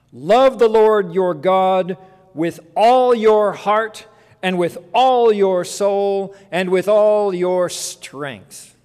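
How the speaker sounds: slow, loud, solemn recital; scripture proclamation. Acted. Preacher quoting Deuteronomy to the congregation, slow loud proclamation. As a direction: proclaim each phrase slowly and forcefully.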